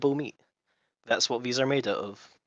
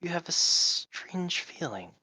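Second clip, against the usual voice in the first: slow, drawn-out lizard voice; lizard accent; 6 semitones higher, slower than usual. Acted. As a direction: stretch it out like a curious lizard. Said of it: "doing a lizard accent".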